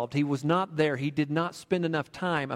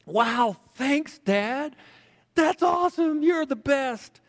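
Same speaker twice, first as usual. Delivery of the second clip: put-on much higher and louder, thrilled child's voice; bursting with joy at the gift; boy thanking his dad excitedly for the money; excited little boy; 11 semitones higher, 3 dB louder, slower than usual. A narrator's reading. Boy gets ten dollars.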